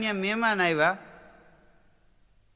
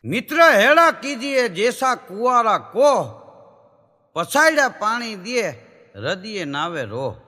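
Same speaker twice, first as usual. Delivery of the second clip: recited couplet; 3 semitones higher, 6 dB louder than usual. Acted.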